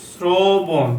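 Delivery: শ্রবণ drawn out as "sroo-bon"; teacher slowly models শ্রবণ; o-sound demo. Shown as a demonstration. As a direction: stretch the rounded o in শ্রবণ. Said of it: "শ্রবণ বলি না কেন?".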